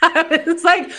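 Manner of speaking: high and loud, laughing through it; giggling while telling the story. Real, not acted. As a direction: laugh out the words. Laughing while retelling reply.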